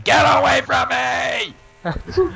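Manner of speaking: high, drawn-out imitated scream; full-voiced mock scream, stretched long. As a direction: scream it out as a panicked driver fleeing a chaser. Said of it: "Rue was just screaming".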